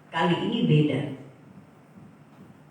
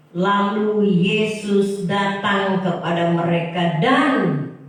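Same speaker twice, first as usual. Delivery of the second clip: preaching build; 3 dB louder, slower than usual. Real, not acted. Preacher unpacks the verse for the church, building louder. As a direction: build each verb up like a sermon climax.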